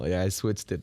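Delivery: ordinary talk, nothing marked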